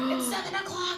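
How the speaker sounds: high, laughing excited cry; mock excited alarm. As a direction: call it out in a playful panic. Acted alarm at show starting.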